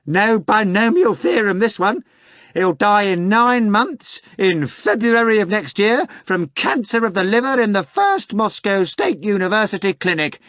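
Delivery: very high mocking cackle of a question; high sneering rotten voice. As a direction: jeer at him from the study. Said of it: "the same rotten voice".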